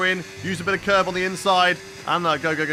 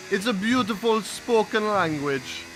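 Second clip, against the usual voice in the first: slow mock Italian accent; lightly held Italian accent; 4 semitones higher, slower than usual. Streamer praising Italian in a put-on Italian accent. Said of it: "put on the accent".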